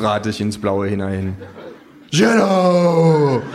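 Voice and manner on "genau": calling out. Acted. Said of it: "ruft das Känguru".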